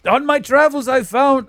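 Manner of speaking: put-on lightly done ancient Greek accent; mock ancient Greek accent. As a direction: play a hammed-up ancient Greek storyteller. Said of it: "this is an ancient Greek out, uh, like, accent".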